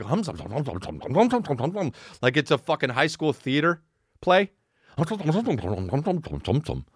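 low theatrical crowd murmur; mock murmuring crowd. Mimicking customers as stage extras, low humming. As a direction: hum like extras in a school play. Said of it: "The customers are just like".

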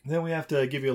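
ordinary talk, nothing marked

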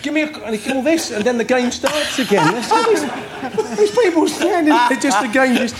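high, breaking with laughter; can barely finish for laughing; real laughter retelling the crowd's reaction; laughing while telling. Real, not acted. Teller laughing at own story.

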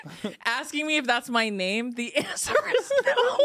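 laughing through the words; real laughter mid-sentence. Real, not acted. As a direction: giggle the answer out. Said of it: "I was, like, laughing".

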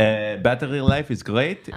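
slow, drawn-out Israeli accent. Shown as a demonstration. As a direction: slowly sell it with an Israeli accent. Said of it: "I could do the Israeli accent".

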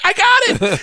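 very high, loud reply; giddy excitement. Retold or imitated. Student following gangster's Russian orders, elated. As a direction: shout back with delight.

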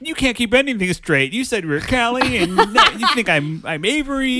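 high, loud, laughing complaint; exasperated yell. Acted. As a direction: shout in hammed-up frustration. Acting out Grady's frustration.

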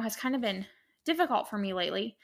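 ordinary talk

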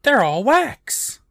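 high, drawn-out mock exclamation; acted-out quote. Host mimicking the character's claim, high mock exclamation. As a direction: stretch it out high, imitating him. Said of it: "He's like".

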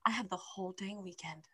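hushed, voiceless aside; whisper. Real, not acted. Telling friend the weekend is free for reading, whispering. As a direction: drop suddenly to a conspiratorial whisper. Confides weekend plan for the book.

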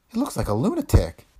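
high-pitched, alarmed remark; gasped horror. A narrator's reading. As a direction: gasp it out in shock. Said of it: "Kathleen gasped".

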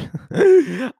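a quick high laugh at herself; bright burst of laughter; short laugh. Real, not acted. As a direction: laugh off the rambling. Laughs at own rambling.

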